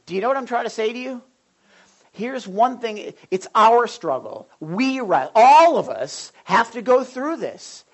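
rally the congregation; fiery preaching. Acted. Preaching at full force.

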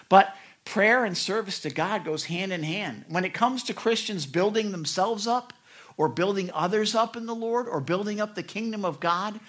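ordinary delivery

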